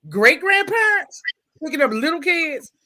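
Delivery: can't-believe-it question; incredulous disbelief. Acted. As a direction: stretch it out high, astonished. Disbelief at great grandparents collecting children.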